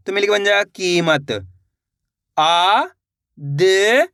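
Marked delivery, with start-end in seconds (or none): 2.4-4.1 isolated, held sounds with long pauses; teacher slowly spelling out by sound; syllable by syllable. Shown as a demonstration. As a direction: sound out each syllable separately for learners. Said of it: "एक अक्षर का उच्चारण करके".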